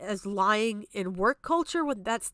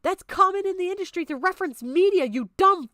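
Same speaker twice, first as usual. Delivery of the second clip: angry retort; 6 semitones higher, 5 dB louder than usual. Real, not acted. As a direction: snap back at him, louder on the insult. Angrily calling out a critic.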